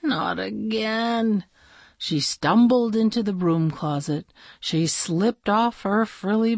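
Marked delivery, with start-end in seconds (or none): 0.0-1.4 sleepy groan. Acted. Sleepy princess woken again.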